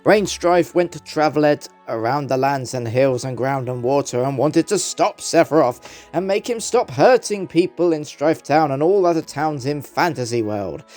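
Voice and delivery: plain reading voice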